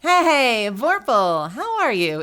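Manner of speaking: loud, high, swooping hello; excited greeting. Real, not acted. Streamer spotting a viewer up past bedtime, excited. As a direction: greet them with big rising excitement.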